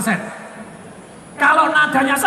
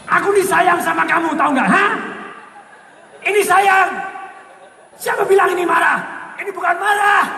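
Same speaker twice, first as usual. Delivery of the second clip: angry tone; 6 semitones higher than usual. Shown as a demonstration. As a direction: say sweet words like a furious scolding. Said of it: "Itu marah". (bentakan marah pura-pura)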